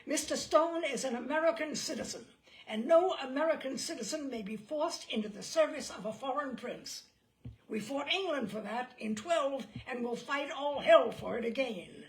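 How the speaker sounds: loud, booming defiance; thundering. A narrator's reading. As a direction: thunder it at the devil himself. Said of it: "he thundered".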